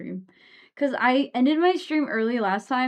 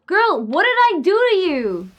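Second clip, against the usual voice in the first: exasperated outcry; 8 semitones higher, 8 dB louder than usual. Real, not acted. Exasperated at enemy hitting her.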